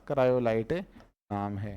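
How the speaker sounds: Cryolite said slowly and low, syllable by syllable; slow drill answer. Shown as a demonstration. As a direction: model the answer slowly for the class. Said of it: "क्या नाम इसका?". (धीमे स्वर में उत्तर दोहराते हुए)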